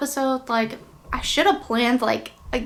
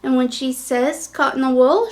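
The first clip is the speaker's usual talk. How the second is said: ordinary delivery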